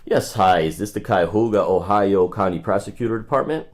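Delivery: ordinary talk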